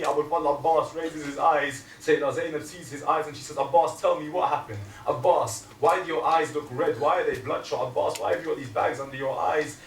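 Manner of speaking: loud mourning sermon; preaching at full force. Acted. Preacher to mourners, loud and fervent. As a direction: drive the story home to the hall.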